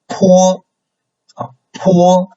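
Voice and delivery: PO held very high and level; high, steady PO; first tone. Shown as a demonstration. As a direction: say PO on one high, flat pitch. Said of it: "the first tone is high".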